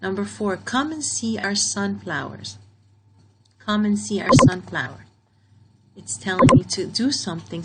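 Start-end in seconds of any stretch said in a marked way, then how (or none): 3.6-5.1 louder, firm command reading. Shown as a demonstration. Teacher reads the sentence out as a firm command. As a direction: say it like a command to the class. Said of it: "so this is a command".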